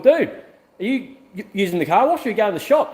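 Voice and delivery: high, whiny mock voice of the other driver. Retelling the car wash driver to viewers, in a high mock voice. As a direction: pitch it up to mimic the rude guy.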